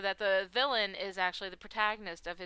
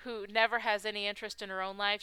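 usual speaking voice

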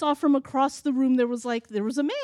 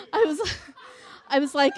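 ordinary delivery

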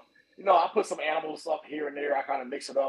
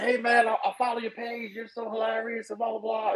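raised gushing fan voice; 8 semitones higher than usual. Retold or imitated. Imitating a fan who loves his page. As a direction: gush like an eager fan.

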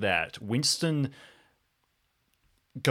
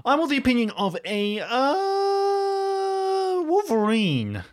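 pitched way up, then drawn out slowly on the last word; a comic squeak that stretches into a slow landing on Wolverine; high squeaky voice; 6 dB louder, slower than usual. Acted. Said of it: "He's like".

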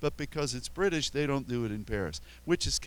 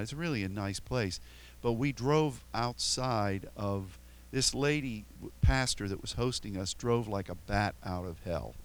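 usual speaking voice

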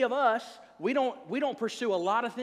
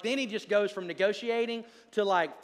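normal talking voice

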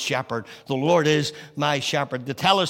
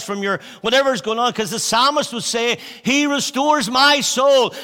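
louder and higher building preacher cry; rising preaching; 6 semitones higher, 5 dB louder than usual. Acted. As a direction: build the appeal to a peak. Preaching climax.

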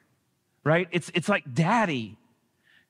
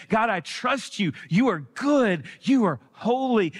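fervent prayer; 6 semitones higher than usual. Retold or imitated. Believers crying out to God, fervent and trusting. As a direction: lift it up like a child trusting God.

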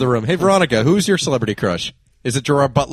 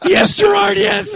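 mock ecstatic cry; much slower than usual. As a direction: swoon over him, hammed up. Mock swooning fan.

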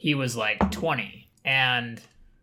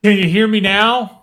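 choppy call audio, loud; coming back in over a bad connection; laggy video call; 8 semitones higher, 11 dB louder than usual. Real, not acted. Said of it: "some of my best content that you missed".

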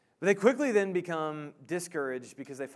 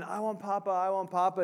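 higher, repetitive imitation of a pleading boy; mock upset toddler repeating a plea; lightly acted child begging over and over; emotional child; 3 semitones higher than usual. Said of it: "repeats over and over again".

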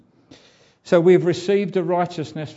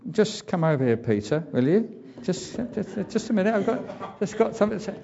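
lightly higher, hesitant call aside to Peter; a hint of an awkward boss taking Peter aside, higher; sheepish pulling aside; 5 semitones higher than usual. Preacher voicing himself pulling Peter aside, higher and hesitant. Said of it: "if it had been me, I would have said".

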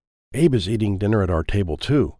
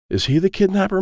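ordinary delivery

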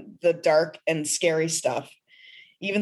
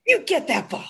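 much higher, excited cheering for a dog; puppy pep talk; 8 semitones higher than usual. Pretend. Guest showing her pep talk to puppy Bootsy, high and excited. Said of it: "Only to my puppy".

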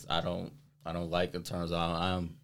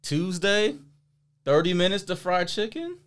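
incredulous; 9 semitones higher, 5 dB louder than usual. Real, not acted. Incredulous about cooking on Tuesday.

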